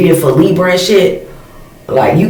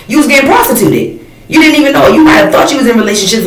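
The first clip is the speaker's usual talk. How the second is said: heated rant; 7 semitones higher than usual. Real, not acted. Ranting to viewers about being used, pitched way up. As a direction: pitch jumps much higher in disbelief.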